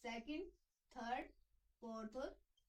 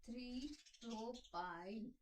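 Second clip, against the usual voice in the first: slow dictation; much slower than usual. Shown as a demonstration. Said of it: "हम उसको क्या बोलेंगे".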